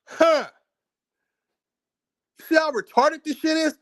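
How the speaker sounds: high-pitched, incredulous mockery; mock reaction. Mocks decipherers' supposed reasoning.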